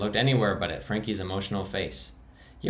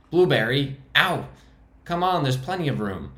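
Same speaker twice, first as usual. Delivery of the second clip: sudden pained yelp, then coaxing; a sharp ow, then a louder plea to the bunny; bitten, yelping; 6 semitones higher, 5 dB louder than usual. Acted. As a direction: cry out as the bunny bites.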